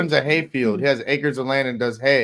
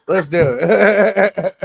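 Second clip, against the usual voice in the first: laughing resignation; 5 semitones higher, 8 dB louder, much slower than usual. Real, not acted.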